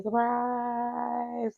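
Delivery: high singsong surprise; high drawn-out surprise; playful surprise. Retold or imitated. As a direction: sing out surprise teasingly. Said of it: "I was like".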